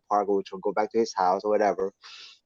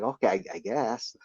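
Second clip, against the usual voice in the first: hesitant, softer going-along; humoring; 7 dB quieter than usual. Retold or imitated. Student humoring his teacher's strange story. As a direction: play along with a rising, unsure tone. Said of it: "kinda humoring him".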